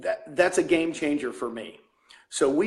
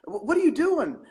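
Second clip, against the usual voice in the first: high-pitched mock pleading with a pal; retold coaxing in a much higher voice; imitating a buddy; 12 semitones higher than usual. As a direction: act out an insistent friend, pitched way up. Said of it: "a buddy of mine in the Philippines".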